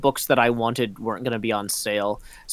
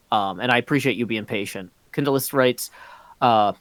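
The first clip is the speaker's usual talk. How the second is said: ordinary talk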